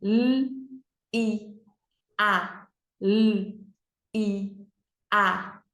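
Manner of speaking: L, I, A as separate "lll, i, a"; letter sounds. Shown as a demonstration. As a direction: hold the L, pause between sounds. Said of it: "três letras". (sonzinho de cada letra: "lll, i, a")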